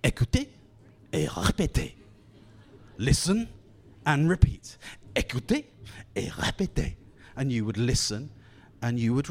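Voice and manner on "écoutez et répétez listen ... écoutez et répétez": a hint of a prim, proper tape voice; mild prim language-tape voice. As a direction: lightly mimic the prim old recording. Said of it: "very prim and proper".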